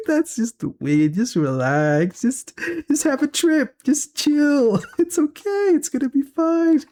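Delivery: laughing reassurance. Retold or imitated. Son laughing as he calms his high parents. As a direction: tell them to chill while laughing. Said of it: "I was laughing".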